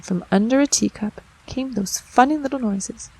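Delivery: ordinary delivery, nothing marked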